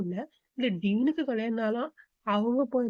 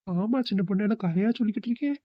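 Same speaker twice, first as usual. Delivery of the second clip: ordinary delivery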